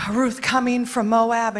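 ordinary talk

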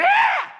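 sudden high piercing scream; demo scream. Shown as a demonstration. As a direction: let out a shrill attention-getting shriek. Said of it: "I wanted to illustrate".

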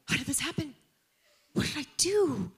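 high-pitched bewildered self-questioning; acted dismay. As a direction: replay your panic at being blamed. Retells panic at being blamed.

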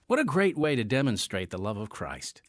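ordinary talk, nothing marked

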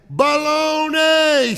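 high, loud, stretched holler; mock yell. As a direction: bellow it out long to test an echo. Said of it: "Yell again".

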